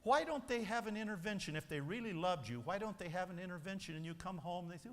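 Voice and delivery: ordinary delivery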